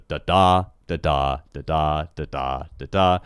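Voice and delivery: each "da, da" a weak beat then a strong one; steady weak-STRONG chant of "da, da"; unstressed-stressed beat. Shown as a demonstration. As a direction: tap out iambs on "da, da", second syllable leaning. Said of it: "you have unstressed and stressed".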